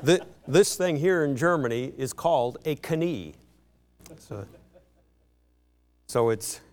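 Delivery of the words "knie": "Knie" with the K pronounced, kuh-NEE; sounded K. Shown as a demonstration. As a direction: say "Knie" the German way, K before the N. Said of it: "have the K's pronounced".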